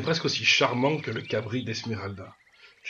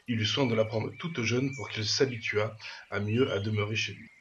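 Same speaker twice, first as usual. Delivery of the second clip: usual speaking voice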